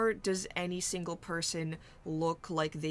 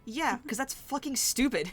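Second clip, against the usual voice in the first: exasperated outburst; 8 semitones higher, 6 dB louder than usual. Real, not acted. Player joke, exasperated.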